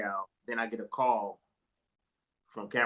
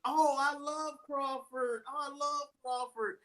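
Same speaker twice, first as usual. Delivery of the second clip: much higher, slow, doting voice; gushing manager; slower than usual. Retold or imitated. Manager refusing to sell Crawford's contract, slow and doting. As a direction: draw it out like a besotted fan. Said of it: "He's just".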